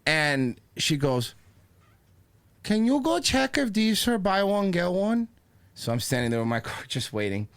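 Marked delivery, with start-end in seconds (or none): 2.6-5.3 high, slow put-on cashier voice; old lady cashier. Old cashier asks the bag boy for a check, high put-on voice. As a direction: drag it out in a high character voice.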